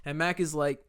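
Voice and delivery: normal talking voice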